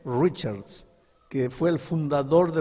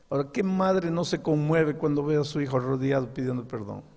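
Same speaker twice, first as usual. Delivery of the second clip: usual speaking voice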